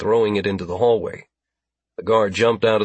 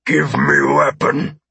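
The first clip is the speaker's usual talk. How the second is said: slow, high alien voice; drawn-out alien command; alien's order; 4 semitones higher, 4 dB louder, slower than usual. Acted. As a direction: demand it forcefully as an alien captor. Alien orders guard to disarm.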